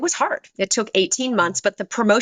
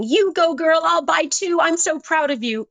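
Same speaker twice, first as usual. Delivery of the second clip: high, bright cheerleading gush; cheering friend; 8 semitones higher than usual. Retold or imitated. Supportive friend gushing over her new book, mock excited. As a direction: hype her up like a proud friend.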